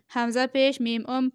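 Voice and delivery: ordinary talk, nothing marked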